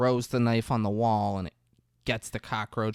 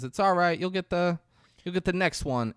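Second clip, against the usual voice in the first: mock encouragement; 7 semitones higher than usual.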